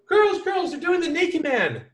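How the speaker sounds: high-pitched, loud mock girl's squeal; excited calling out. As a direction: call the girls over, high and thrilled. Retold girl excitedly calls her friends.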